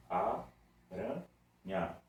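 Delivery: "Aranha" slowly as a, ra, nha; three separate beats for "Aranha"; syllable by syllable. Shown as a demonstration. Teacher counting three syllables, "Aranha" beat by beat. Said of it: "Aranha tem três sílabas pra pronunciar ela".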